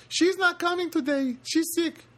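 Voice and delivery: high, slow imitation of a Filipino accent. Imitating the guard answering the phone, Filipino accent. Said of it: "his Filipino accent".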